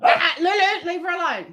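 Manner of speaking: high warning call; sharp high uh-uh to stop her; scolding the puppy. Real, not acted. To Lulu bothering the old dog, a high scolding warning.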